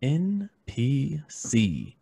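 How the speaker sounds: very slow, letter by letter; spelled out with suspense; dramatic title reveal. Pretend. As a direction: draw out each letter of "N P C". Announces story title dramatically.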